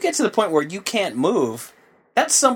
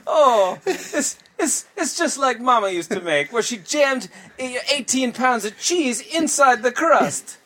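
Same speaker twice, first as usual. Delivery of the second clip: put-on high, slow, hammy Italian accent; jolly Italian pizza chef; 10 semitones higher, slower than usual. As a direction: gush like a nonna's proud son. Mock Italian ad, Mama.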